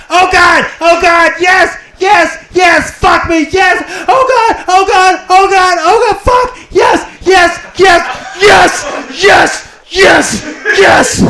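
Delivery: very high, loud ecstatic cries; woman climaxing. Retold or imitated. Comic imitating woman climaxing, high loud ecstatic cries. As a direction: scream in ecstasy, over and over. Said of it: "comes like this".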